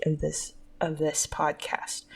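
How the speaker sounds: soft unvoiced whisper. Real, not acted. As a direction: whisper it, trailing off. Drops to whisper.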